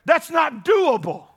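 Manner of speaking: ordinary talk, nothing marked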